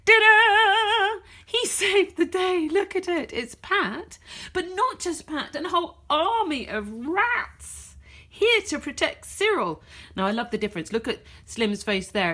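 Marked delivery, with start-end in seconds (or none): 0.1-9.8 much higher and louder, triumphant. Acted. Pat the rat showing off his rat army, triumphant. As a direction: burst in like a hero arriving. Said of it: "said Pat".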